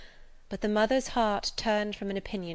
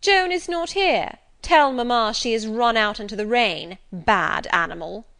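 bully's shout; 3 semitones higher, 8 dB louder than usual. A narrator's reading. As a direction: spit it out like a spoiled bully. Bully boy shouting in the room.

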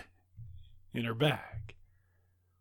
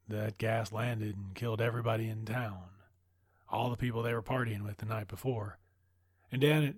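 ordinary delivery

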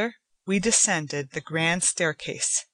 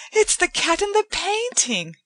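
put-on much higher delighted young woman; Joanna, thrilled; 13 semitones higher, 4 dB louder than usual. A narrator's reading. Joanna recognises the cat from the painting, much higher and thrilled. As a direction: recognise him with a gasp of joy. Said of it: "Joanna said".

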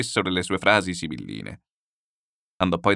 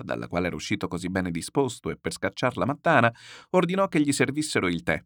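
ordinary delivery, nothing marked

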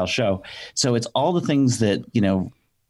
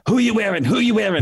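Joan Rivers; 10 semitones higher than usual. Retold or imitated. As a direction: gush like a red carpet interviewer. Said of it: "they hired me to be Joan Rivers on the red carpet".

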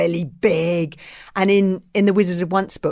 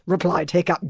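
usual speaking voice

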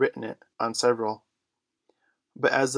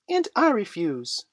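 put-on high, loud little-boy defiance; Michael crying out; 10 semitones higher, 5 dB louder than usual. A narrator's reading. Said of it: "cried Michael".

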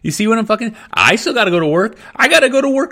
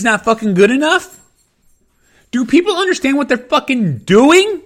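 building angry rant; 5 semitones higher than usual. Real, not acted. Building rant about discrimination.

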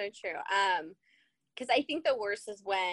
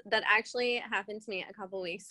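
ordinary delivery

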